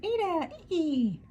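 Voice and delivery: very high, laughing cheer of both names. A narrator's reading. Friends greeting Ada and Iggy with a laughing cheer. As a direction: call out the names with delight. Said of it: "they cheered".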